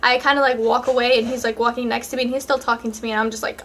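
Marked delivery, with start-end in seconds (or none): none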